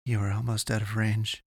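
ordinary delivery

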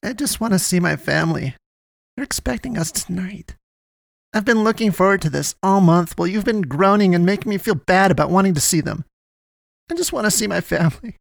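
high whimpering, acted tearful voice; tearful pleading. A narrator's reading. Poe misses the family expecting them tonight, tearful. Sobbing complaint continues.

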